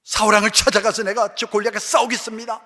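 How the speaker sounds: acting out young David storming to the king; huffing angry youth. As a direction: puff up like a furious boy ready to fight. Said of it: "씩씩거리고".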